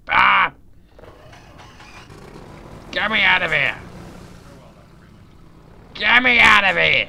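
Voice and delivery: high, loud panicked yelling; desperate yell for help; panicked shout. Real, not acted. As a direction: scream to be let out.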